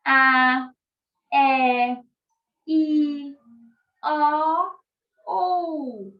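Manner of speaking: loud open vowels A, E, I, O, U said one by one, rising. Shown as a demonstration. Teacher shows the children open vowel sounds. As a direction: sing out each vowel wide open. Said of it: "vogal tem som aberto".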